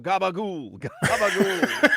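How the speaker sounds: high, loud, drawn-out yell; mock yelling. Host suggesting what the passenger should yell, mock yelling. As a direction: holler it as a joke, stretched out. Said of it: "You should have started yelling out".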